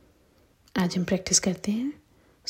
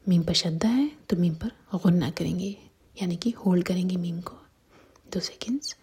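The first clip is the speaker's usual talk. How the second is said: whisper. Real, not acted. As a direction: whisper each rule gently to the listener. Whispered Quran tajweed lesson.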